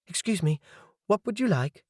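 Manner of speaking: put-on serving girl voice. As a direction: play the polite young waitress. Waitress greets customer at table.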